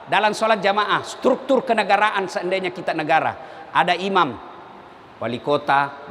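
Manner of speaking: normal talking voice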